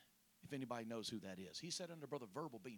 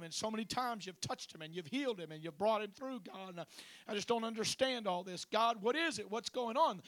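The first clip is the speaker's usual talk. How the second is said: higher, louder anguished pleading to God; pleading prayer; 8 semitones higher, 8 dB louder than usual. Retold or imitated. Pastor praying aloud in his truck, pleading. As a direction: cry out to God, not understanding. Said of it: "just praying to God".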